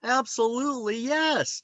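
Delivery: thrilled, saying yes to the psychologist; eager yes. Retold or imitated. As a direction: jump at the offer. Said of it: "And it's like".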